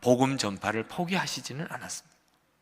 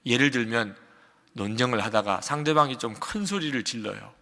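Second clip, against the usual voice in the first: ordinary talk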